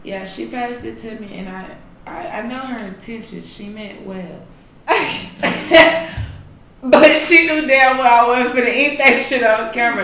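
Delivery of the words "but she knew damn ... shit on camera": laughing. Real, not acted. Laughing story about niece.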